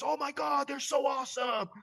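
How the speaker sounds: high, shouted gush; imitating excited delight. Founder thrilled at the pillow gift, high excited shout. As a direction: play up the founder loving the gift.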